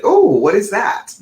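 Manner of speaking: high, loud curious exclamation; intrigued surprise. Retold or imitated. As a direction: mimic a listener suddenly intrigued. Acted reaction of intrigued listeners.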